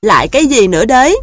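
fast, loud, fed-up snap; annoyed lead goose; exasperated goose. A narrator's reading. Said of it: "Akka đáp lại". (giọng ngỗng đầu đàn bực bội)

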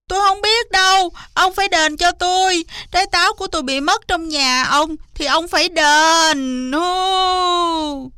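high, fast, wailing fox; hammed-up high-pitched caterwauling; howling tantrum. A narrator's reading. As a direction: throw a loud whining fit, ending in a long howl. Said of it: "lăn ra đất tru tréo lên".

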